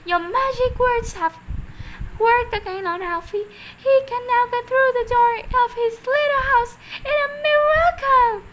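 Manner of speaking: high, loud thrilled cry; excited cry. A narrator's reading. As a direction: cry out in delight. Mrs Silver shouting up, thrilled.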